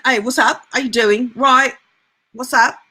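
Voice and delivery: put-on thick London British accent; casual Brixton bloke greeting, thick accent; Idris Elba. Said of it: "very thick accent".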